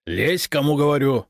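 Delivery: impatient commanding bark; barked order. A narrator's reading. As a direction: order a subordinate sharply. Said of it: "рявкнул Прудников".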